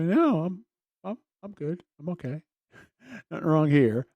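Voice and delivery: sarcastic denial. Retold or imitated. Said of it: "I go".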